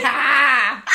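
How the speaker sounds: shouted Boston "car" through laughter; Boston accent. Pretend. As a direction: yell it in mock Boston, no R. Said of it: "drop the final R".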